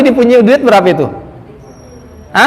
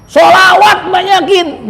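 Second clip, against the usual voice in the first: high, slow exhortation; preaching shout; 10 semitones higher, slower than usual. Real, not acted. Preacher urging the congregation to pray more, high and slow. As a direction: call it out to the congregation.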